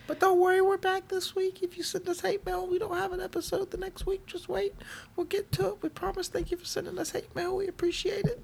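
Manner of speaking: high squeaky mock-sweet voice. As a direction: talk like a cartoon chipmunk. High put-on voice to listeners.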